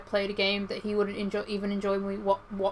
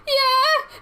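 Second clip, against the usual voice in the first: squeaky yeah; 5 dB louder than usual. Retold or imitated. Sheepish reply, retold.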